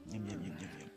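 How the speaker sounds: ordinary talk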